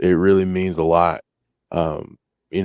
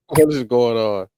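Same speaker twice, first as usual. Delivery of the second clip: chipmunk voice; 4 semitones higher than usual. Real, not acted. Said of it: "you literally sound like a chipmunk".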